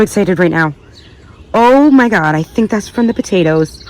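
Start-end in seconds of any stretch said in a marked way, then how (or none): none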